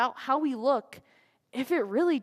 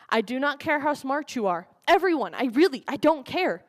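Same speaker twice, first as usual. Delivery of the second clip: ordinary delivery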